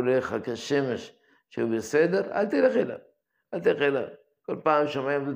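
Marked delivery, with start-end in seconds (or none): 2.3-3.0 high, pressing stress on תלך; emphatic warning. Real, not acted. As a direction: drive the warning home hard. Rabbi warns against unclear places.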